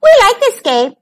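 high little mouse voices; high-pitched squeaky chirp; squeak. A narrator's reading. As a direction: squeak like eager small animals. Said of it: "they squeaked".

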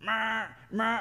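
repeated high cow bleats; drawn-out mock moos; imitated cattle calls. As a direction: answer like a herd of cattle. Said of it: "cattle, they just start going".

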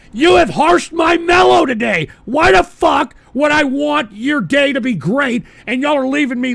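shouting, outraged and loud; angry shout. Real, not acted. Streamer yelling at his chat, furious shout.

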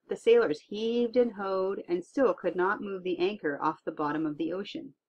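plain reading voice

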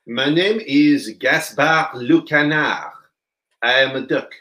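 put-on slow, loud French-accented duck voice; a comic French duck puppet voice. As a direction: play a proud French duck. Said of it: "Who's this?".

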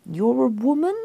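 high, uncertain questioning lilt; a clueless guess trailing upward; very vague. A narrator's reading. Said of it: "Pete, very vaguely".

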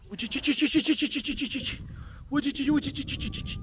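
high soft chirping call to the animals; calling sound. Shown as a demonstration. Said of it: "seslenme güzel".

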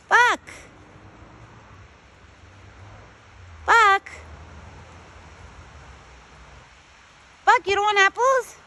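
high, loud calls of a pet's name; calling out. Real, not acted. Woman calls her buck back for apples, calling out. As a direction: holler for an animal that has wandered off.

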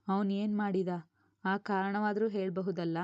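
plain reading voice

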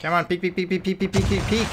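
rapid-fire repeated urging, rising in pitch; urgent coaxing. Real, not acted. Player to hidden enemy in game, urgent coaxing. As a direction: chant it eagerly, building up.

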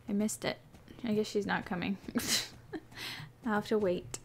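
ordinary talk

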